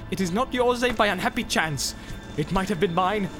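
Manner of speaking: high-pitched, mounting fury; exasperated tirade rising in pitch; angry outburst. A narrator's reading. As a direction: rage at a fool, building to a demand. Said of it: "cried Boromir".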